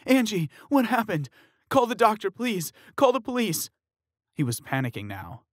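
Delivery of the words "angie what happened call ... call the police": high, loud frantic pleas; panicked shouting. Acted. Fiancé begging the crowd for help, panicked shout.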